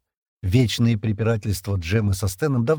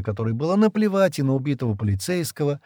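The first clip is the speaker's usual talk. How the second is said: plain reading voice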